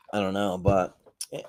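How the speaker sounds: ordinary talk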